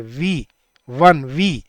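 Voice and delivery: वी said as a clear w sound, then वन, then वी; first sound demo. Shown as a demonstration. Teacher showing the first sound of वन as वी. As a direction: isolate the w sound of वन as वी. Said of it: "पहली sound क्या आ रही है".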